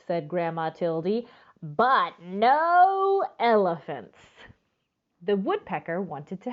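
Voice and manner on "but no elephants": drawn-out, slow "but no elephants"; slow firm refusal. Acted. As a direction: stretch each word out, firmly. Grandma's firm refusal again.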